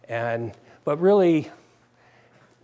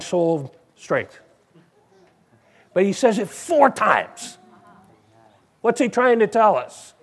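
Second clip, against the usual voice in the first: ordinary talk, nothing marked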